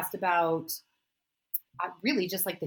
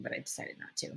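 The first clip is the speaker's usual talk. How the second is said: whispered aside; 14 dB quieter, faster than usual. Real, not acted. Whispered aside after self-mockery.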